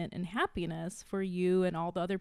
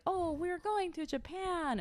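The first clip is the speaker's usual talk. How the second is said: high, slow mock movie line; movie character; 10 semitones higher, 4 dB quieter than usual. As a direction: imitate a cheesy film character, drawn out. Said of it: "a movie like".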